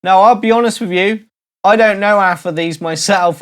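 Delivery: ordinary delivery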